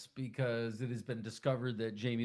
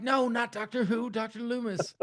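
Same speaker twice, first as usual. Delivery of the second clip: much higher correcting voice; hammed-up correction of the name; a hint of an exasperated caller; 10 semitones higher, 3 dB louder than usual. Doctor correcting the mother on the phone.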